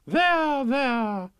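high, fluting comfort; high-voiced queenly there-there; soothing queen. A narrator's reading. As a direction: console in a high put-on royal voice. Queen soothes the whimpering King.

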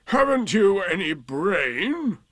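withering, long-drawn put-down; Eeyore. A narrator's reading. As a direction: draw the question out with heavy sarcasm. Eeyore scolding Rabbit.

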